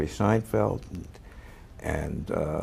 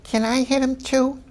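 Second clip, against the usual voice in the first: imitating Estelle. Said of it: "And Estelle Harris said".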